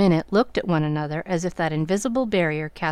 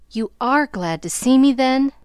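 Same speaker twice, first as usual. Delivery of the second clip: eager question; 6 semitones higher, 4 dB louder than usual. Acted. Reunited friend asks teasingly.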